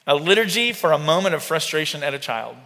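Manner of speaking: ordinary talk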